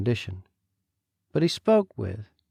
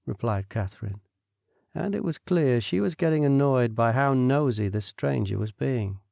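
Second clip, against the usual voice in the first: ordinary delivery, nothing marked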